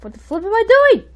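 exasperated at yourself, pitch shooting up; flustered outburst. Real, not acted. Player botching a move in a match, flustered outburst. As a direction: voice climbing high and loud.

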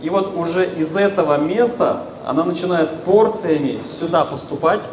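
ordinary talk, nothing marked

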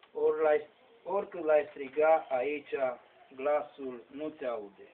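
loud, emphatic declamation of sacred verse; poem recitation. Acted. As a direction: ring out each verse line with a pause after it.

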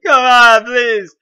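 loud, high exasperated plea; pleading. Real, not acted. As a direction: beg the game in frustration. Pleads after failing the jump.

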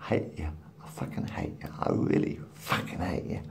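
hissed, voiceless cursing under the breath; quiet seething whisper; whispered curses. Real, not acted. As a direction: whisper the hate through the teeth.

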